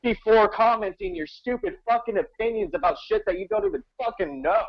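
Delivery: indignant, pitched well up; exasperated rant. Real, not acted. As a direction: rail at ignorant critics in a high voice. Exasperated rant at offended viewers.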